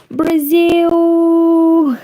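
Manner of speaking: loud, high Brazil!; excited shout. Real, not acted. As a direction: cheer the word Brazil!.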